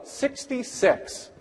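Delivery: put-on high, slow, drawn-out child's answer; proud kid answer. First grader answering the teacher, high and drawn-out. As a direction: answer like a pleased six-year-old. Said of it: "They'll go".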